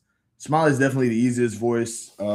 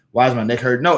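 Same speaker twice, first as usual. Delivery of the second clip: normal talking voice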